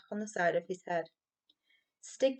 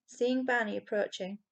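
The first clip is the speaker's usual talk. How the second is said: ordinary delivery, nothing marked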